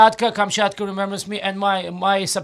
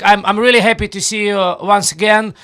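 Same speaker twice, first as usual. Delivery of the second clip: ordinary talk, nothing marked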